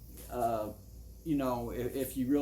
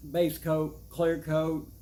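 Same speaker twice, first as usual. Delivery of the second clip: ordinary talk, nothing marked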